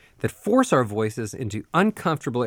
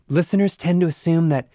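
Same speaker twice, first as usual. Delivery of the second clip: ordinary talk, nothing marked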